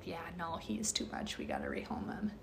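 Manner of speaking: soft whisper, offhand; whispered quote. Retold or imitated. Owners quoted deciding to rehome, soft whisper. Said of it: "they were like".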